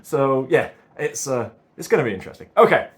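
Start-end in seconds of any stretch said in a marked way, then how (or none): none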